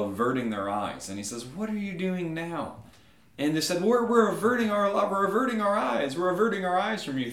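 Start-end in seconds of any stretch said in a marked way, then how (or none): 1.4-2.8 higher, slow, weary question; annoyed God. Acted. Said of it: "he's kind of annoyed by us". 3.8-7.3 high, loud, stammering excuse; cowering subjects. Acted. Cowering men answer annoyed God.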